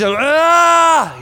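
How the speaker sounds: loud, high, drawn-out scream; high-pitched "aah" at full voice; imitated scream. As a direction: let out a loud agonized yell, acting it out. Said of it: "He starts screaming".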